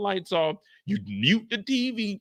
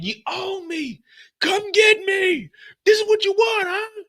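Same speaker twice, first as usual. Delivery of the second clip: yelling Lou; 10 semitones higher, 4 dB louder than usual. Retold or imitated. Lou shouting threats down the street, high loud taunt. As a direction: taunt him to come.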